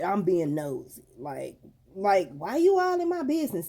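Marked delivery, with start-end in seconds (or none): 2.3-3.7 imitating a kid's indignant attitude, higher pitch; mock-offended teen pushing back. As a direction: throw it out high, like an annoyed daughter. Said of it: "type of attitude".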